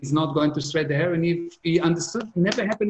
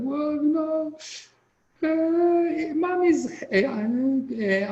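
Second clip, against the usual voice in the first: put-on little boy voice; 12 semitones higher, much slower than usual. Father voices young son.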